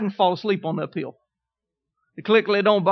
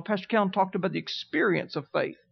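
ordinary delivery

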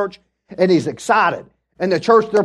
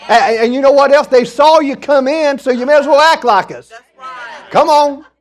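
high, loud, fired-up exhortation; rousing preaching; 6 semitones higher, 4 dB louder than usual. Real, not acted. Preacher telling the congregation to act like us, loud and rousing. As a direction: fire up the church with a raised voice.

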